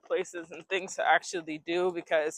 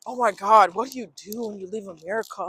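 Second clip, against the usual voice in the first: imitated questioners; 3 semitones higher, 8 dB louder than usual. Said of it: "people always ask me".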